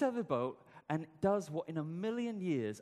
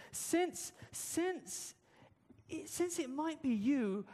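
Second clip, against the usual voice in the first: higher, stammering unsure Peter; hesitant Peter; 12 semitones higher, much slower than usual. Acted. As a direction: stumble over since, unsure of yourself. Doubting Peter hesitating on the water.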